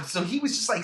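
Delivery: usual speaking voice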